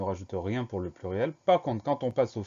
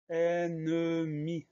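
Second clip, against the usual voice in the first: very drawn-out syllables, raised pitch; slow dictation; 6 semitones higher, much slower than usual. Shown as a demonstration. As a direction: stretch each syllable for the learner. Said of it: "c'est le mot".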